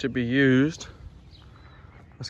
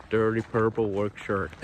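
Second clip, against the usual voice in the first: Southland rolled R; 2 semitones lower than usual. Retold or imitated. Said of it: "and it sounds like".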